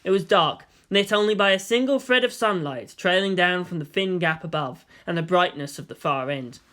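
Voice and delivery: usual speaking voice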